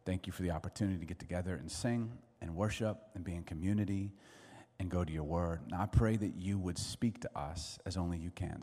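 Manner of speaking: low, soft, earnest prayer; softly spoken congregational prayer. Real, not acted. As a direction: pray quietly and reverently, in hushed low tones. Opening prayer before the talk.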